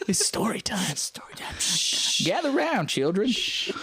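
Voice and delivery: hushed, high storyteller voice. Pretend. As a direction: whisper to the kids, then rise up high. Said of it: "You have to do that the whole time now".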